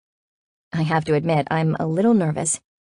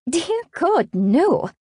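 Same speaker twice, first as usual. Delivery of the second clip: high-pitched, playfully appalled French friend; amused horror; 12 semitones higher, much slower than usual. A narrator's reading. French friend asked about wearing jeans, appalled.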